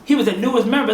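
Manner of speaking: usual speaking voice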